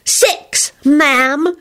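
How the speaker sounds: high little voice, gravely formal; grave child. A narrator's reading. Teddy tells the guest his age, gravely. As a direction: answer gravely as a small boy. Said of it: "he said gravely".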